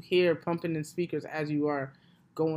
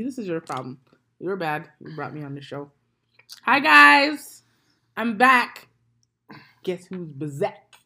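ordinary talk

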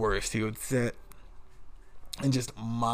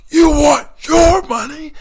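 very high, very loud drawn-out scream; imitated screaming boss; 17 dB louder, slower than usual. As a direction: scream it slowly as the enraged boss. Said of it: "he would just yell and scream".

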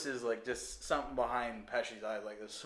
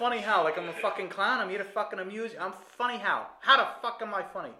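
Pesci tirade; 7 semitones higher, 5 dB louder, faster than usual. Retold or imitated. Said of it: "Let him talk".

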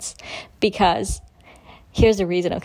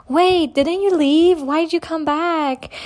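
surprised receptionist; 7 semitones higher, slower than usual. Retold or imitated.

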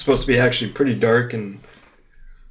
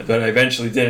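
ordinary talk

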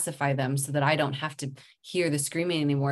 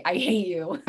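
laughing through a mock toddler line; laughing child quote; 3 semitones higher than usual. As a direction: toss it off with a laugh. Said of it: "wanted to say".

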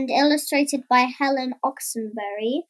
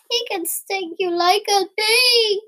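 high-pitched cry of warning; alarmed warning; 6 semitones higher than usual. A narrator's reading. Frightened Bear warns Mummy Frog about the giant, high alarmed voice. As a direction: warn her urgently, pitched high.

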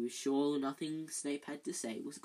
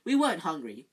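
said loudly; 8 dB louder than usual. Acted. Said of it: "said Ron loudly".

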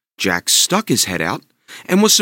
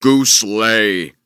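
giant's order; 5 dB louder than usual. Acted. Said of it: "the giant ordered".

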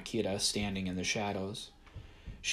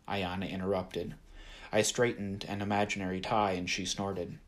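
usual speaking voice

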